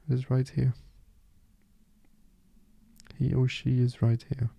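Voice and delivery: soft, unhurried meditation guide, with long pauses; softly spoken guide leaving space between phrases; guided meditation. Acted. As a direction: settle the listener gently into stillness.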